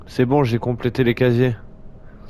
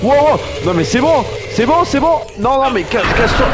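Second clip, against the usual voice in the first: high, loud panicked shouts; panicked yelling; 13 semitones higher, 4 dB louder than usual. Real, not acted. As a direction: yell in a panic. Panics as monster appears.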